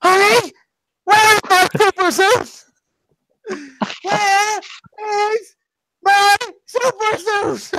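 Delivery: very high, loud, drawn-out yell of the movie line; screamed film quote. Retold or imitated. Brothers screaming the movie line around the house, very high and slow. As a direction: scream it around the house, stretching each word. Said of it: "just screaming that around the house".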